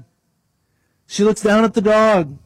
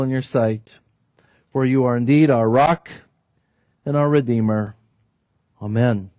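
hushed, lower prayer voice; 5 semitones lower than usual. Acted. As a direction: pray it reverently, settling down. Pastor leads opening prayer.

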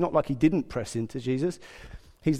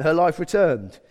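usual speaking voice

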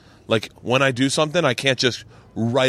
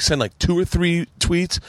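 ordinary talk, nothing marked

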